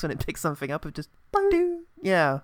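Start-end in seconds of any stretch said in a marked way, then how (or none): 1.5-1.8 high sonar "doo"; ping like a sonar. Pretend. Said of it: "that sound that, like, a sonar makes".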